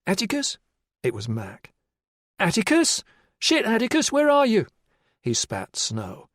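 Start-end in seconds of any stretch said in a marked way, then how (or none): 0.1-0.6 high anxious call into the dark. Acted. Mac searching the snowy track, calling out worried. 2.4-4.7 high, loud, urgent calls; calling out. Acted. Mac searching the snowy track for him, frantic loud calling.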